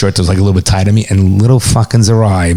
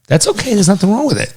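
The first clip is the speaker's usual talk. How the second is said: reassuring; 12 semitones higher than usual. Real, not acted. Comforting his emotional friend.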